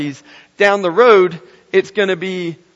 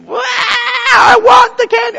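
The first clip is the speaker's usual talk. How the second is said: put-on high, slow, loud wailing child; whiny kid; 8 dB louder, slower than usual. Kid in grocery cart wailing for candy, high whiny shout. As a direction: wail it like a kid who wants candy. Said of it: "the kid".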